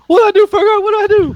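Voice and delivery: very high, loud squealing donkey; frantic cartoon sidekick begging for orders; squeaky cry for help; panicked donkey. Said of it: "Donkey's like".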